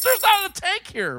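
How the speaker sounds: very high, strained protest; exasperated. Retold or imitated. Son-in-law insisting there is no tank, exasperated. Retold argument, caller disputes it.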